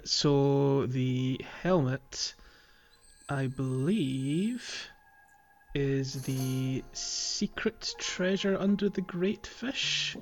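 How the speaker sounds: normal talking voice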